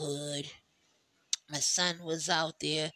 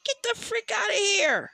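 much higher, louder outraged what; mock outrage at sold-out slushies; shocked disbelief; 6 dB louder than usual. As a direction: act out hearing they sold out. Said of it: "I'm like".

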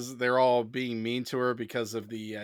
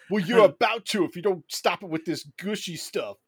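high, louder bully-robot voice; robot mocking the teens' gushy talk; Alpha Six quote; 6 semitones higher, 5 dB louder than usual. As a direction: threaten like a cocky robot. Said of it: "he's like".

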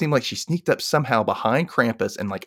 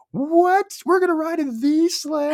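put-on high, thrilled kid voice; Percy's excited about riding the sleigh, much higher. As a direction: squeal with delight at the sleigh. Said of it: "Percy's just like".

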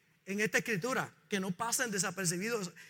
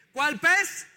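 calling out; 5 semitones higher, 5 dB louder than usual. Real, not acted. Preacher quizzes congregation loudly.

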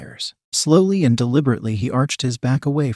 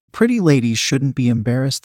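plain reading voice

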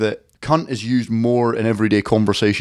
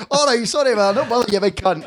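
higher put-on pub bloke; mock stranger voice; 9 semitones higher than usual. Storyteller voices the stranger's apology, higher put-on voice. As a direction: play the bloke apologising, pitched up.

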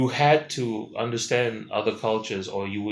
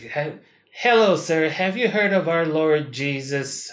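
higher, slowed-down doorstep preacher; mock door-knocking missionary; 4 semitones higher than usual. Missionary at the door asking about Jesus, mock earnest. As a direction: put on an earnest missionary's pitch. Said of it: "the Jesus knocking on my door thing".

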